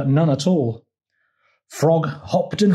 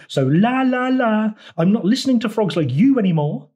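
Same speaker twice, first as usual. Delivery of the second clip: high la-la refusal to listen; childish taunting; 9 semitones higher, 4 dB louder than usual. A narrator's reading. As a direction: drown out a rival in a high singsong. Toad blocks out frog, la-la taunt.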